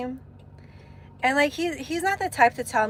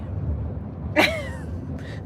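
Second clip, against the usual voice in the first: high giggle. Real, not acted. Laughs at own vow.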